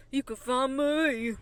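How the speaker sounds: slow, drawn-out country singer impression. Host doing her country singer impression after a countdown. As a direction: stretch the words like a country star. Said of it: "my impression of [name]".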